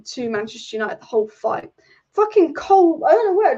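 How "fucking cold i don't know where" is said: sudden loud, high yelp of shock at a cold draught; jolted by a chill, blurt it out loud and high; real startled cry, louder and higher; startled outburst. Real, not acted. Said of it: "Scared the shit out of me".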